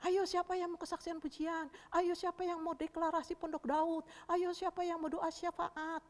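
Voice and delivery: teacher calling for volunteers. Acted. Kak Ita asking children who will volunteer, inviting singsong. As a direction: coax the children with a repeated chant. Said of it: "Disuruh misalnya Kak Ita bilang".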